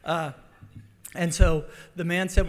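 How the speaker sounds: ordinary delivery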